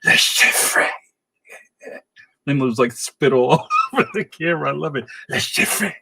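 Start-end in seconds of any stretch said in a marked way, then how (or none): 0.0-0.9 slow, voiceless venomous hiss, imitating a film close-up; mock villain-confronting hiss; Judi Dench hiss. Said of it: "she hisses his name". 5.3-5.9 imitated film hiss, all breath; quick mock hiss of a stern spymaster; Judi Dench hiss. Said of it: "she hisses his name".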